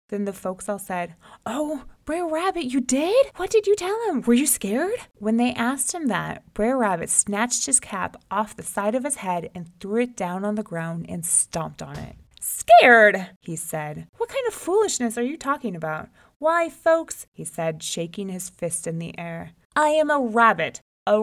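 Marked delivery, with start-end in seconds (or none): 1.5-5.0 high, eager gasping questions; amazed eager folks. Acted. Folks amazed at the rabbit's tale. 12.4-13.3 loud, offended bark; indignant outburst. Acted. Rabbit stomping his cap, outraged at the folks. 14.2-17.3 indignant bluster. Acted. Rabbit shaking his fist at the doubting folks.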